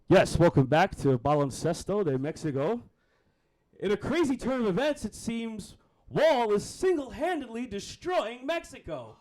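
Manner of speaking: loud, projected announcer voice; sports broadcast host. Acted. Host opening a Mexican basketball broadcast, big announcer voice. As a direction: belt it out like a TV commentator.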